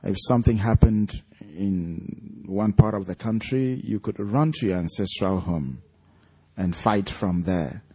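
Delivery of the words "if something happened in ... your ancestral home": usual speaking voice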